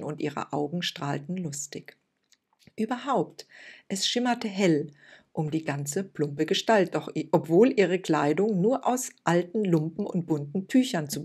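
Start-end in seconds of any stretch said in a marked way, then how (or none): none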